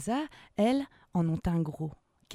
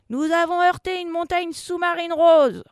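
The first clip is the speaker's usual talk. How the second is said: loud, high emergency report; alarmed shout; 10 semitones higher, 10 dB louder than usual. Acted. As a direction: report the collision in a panic. Alarm report after the collision. (annonce criée, affolée)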